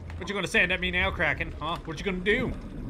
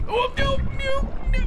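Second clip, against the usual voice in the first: panicked cry; 3 dB louder than usual. Real, not acted. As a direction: wail as it all goes wrong. Panic at an enemy still alive.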